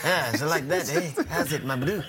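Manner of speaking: lightly done South African gangster drawl; a hint of South African gangster swagger; South African accent. Shown as a demonstration. Cricketer trying a South African accent, lightly gangster. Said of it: "Sounded a little bit like a gangster".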